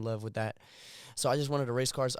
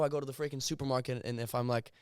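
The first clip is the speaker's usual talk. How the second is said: ordinary talk, nothing marked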